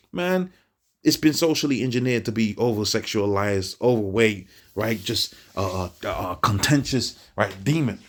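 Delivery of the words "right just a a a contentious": normal talking voice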